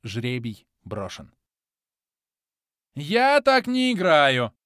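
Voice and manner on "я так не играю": high, loud, slow whining cry; drawn-out wail. A narrator's reading. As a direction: drag out the protest. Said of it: "протянул Кармадонт".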